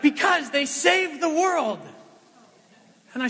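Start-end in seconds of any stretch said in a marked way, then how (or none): 0.0-1.8 put-on much higher, slow, earnest child voice; son's answer. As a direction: answer like a proud little boy. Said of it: "he's like".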